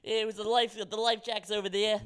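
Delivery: a hammed-up Boston flight attendant; Boston accent. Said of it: "I wish I could do a better Boston accent".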